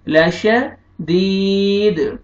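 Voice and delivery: लशदीद very slow, final द bounced; qalqala. Shown as a demonstration. Teacher modelling qalqala. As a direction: draw out लशदीद, echo the द. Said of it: "तो दाल कलकला हो जाएगा".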